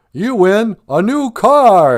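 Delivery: loud, high, drawn-out prize reveal; game show announcer. Acted. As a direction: announce the big prize slowly at high volume. Game show announcer awarding a car.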